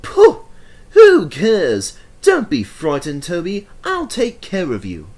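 high, loud, scoffing bravado; boastful engine. Acted. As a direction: scoff it off, then reassure. Thomas boasting bravely.

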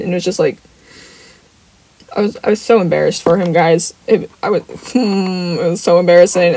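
ordinary delivery

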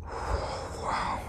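soft, breathed-out wonder; barely voiced, savouring; awed whisper. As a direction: exhale it in hushed awe. Vampire savouring smell of blood.

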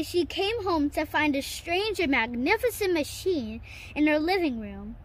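ordinary delivery

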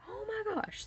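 very high mock-panicked gasp of words; squeaky-high imitation of alarm; retold freak-out. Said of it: "So I'm freaking out".

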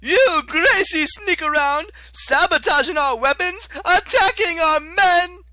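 whiny high-pitched fury, dragged out; Octavian, shrill and outraged. Acted. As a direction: screech like a wounded petty tyrant. Octavian character voice.

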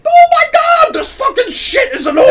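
high, loud fed-up yell; frustrated outburst. Real, not acted. Player fed up with the failed roll, yelling. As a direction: blow up at the game, loud and high. Game roll goes wrong again.

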